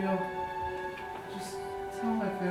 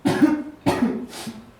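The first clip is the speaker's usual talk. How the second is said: weak cough; 7 dB louder than usual. Acted. Wounded soldier coughing.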